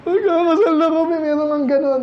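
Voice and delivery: lightly mock a groom choking up; teary groom. Groom tearing up at his own wedding, mock-tearful. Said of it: "naiiyak-iyak pa lalaki".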